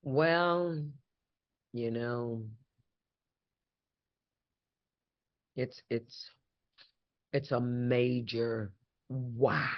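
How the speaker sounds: fighting back tears, slow and hushed; choked up. Real, not acted. A guest overcome with emotion about her hometown honor, choked up. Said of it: "you see me so emotional right now".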